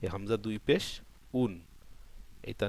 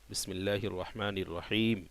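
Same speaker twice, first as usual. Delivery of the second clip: slow, measured Arabic recitation; 2 semitones lower, 4 dB quieter than usual. Shown as a demonstration. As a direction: recite the opening formula slowly, drawing out the long vowels. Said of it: "তাহলে আমরা পড়ি". (ধীরে টেনে তিলাওয়াত)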